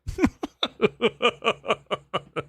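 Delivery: high, rapid staccato laugh; long chuckle in quick pulses. Real, not acted. Podcaster mocks a writer's Rhythm Nation review, high staccato laughter.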